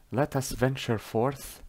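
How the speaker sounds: ordinary talk, nothing marked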